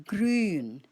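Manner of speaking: "grün" with a long rounded ü; long umlaut. Shown as a demonstration. Teacher modelling the long ü for learners. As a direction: stretch the ü in "grün". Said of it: "and you say it long".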